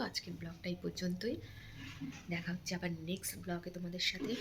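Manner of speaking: quiet, hushed vlog chat; speaking softly. Real, not acted. Sleepy vlogger signing off beside the sleeping little one, speaking softly. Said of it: "আস্তে আস্তে কথা বলছি".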